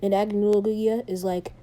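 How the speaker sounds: ordinary delivery, nothing marked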